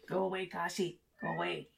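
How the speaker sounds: quiet aside shooing the cat away; quiet real aside to the pet; soft shooing. Real, not acted. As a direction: murmur it to the cat, off to the side. Cat wanting to come in.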